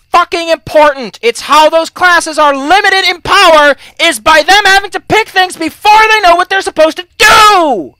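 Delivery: loud, high, drawn-out exasperated shouting; exasperated rant. Real, not acted. Ranting at lax dungeon masters about spell rules, exasperated shouting. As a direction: build to an indignant shout, stretching the key words.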